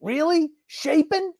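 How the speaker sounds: high, slow incredulous coach; squeaky, drawn-out mock shock; mock coach disbelief. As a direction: play a coach who can't believe it. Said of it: "They go in there like".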